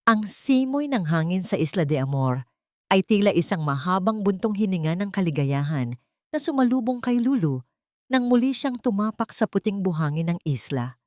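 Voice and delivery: ordinary talk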